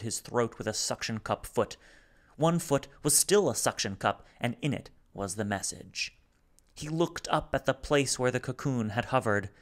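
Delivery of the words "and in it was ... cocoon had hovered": usual speaking voice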